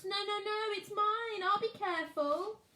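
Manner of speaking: put-on high childish pleading; small boy pleading. Max begging Fred to let him keep the branch, high pleading. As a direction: hold on to your toy and beg.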